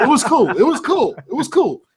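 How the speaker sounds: giggly high repeats; laughing it away. Real, not acted.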